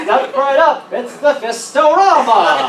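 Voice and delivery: hammed-up carnival barker pitch. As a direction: bark out the come-on.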